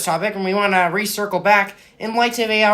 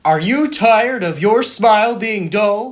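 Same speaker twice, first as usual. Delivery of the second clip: hammed-up announcer asking the listener; slow, selling radio-ad voice; ad pitch. Announcer pitching toothpaste to listeners, hammy ad read.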